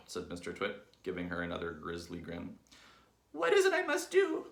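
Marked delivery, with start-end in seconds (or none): 3.3-4.5 very high, loud wail; Mrs. Twit's frantic cry. A narrator's reading. Wife clutching her cheeks, frantic high cry. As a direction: cry out in squeaky desperation. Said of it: "cried Missus Twit".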